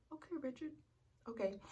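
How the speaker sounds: high, quiet, sarcastic "Okay, Richard". Retold or imitated. Mocking the boyfriend who told her to grow up, high and sarcastic. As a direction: let it drip with sarcasm.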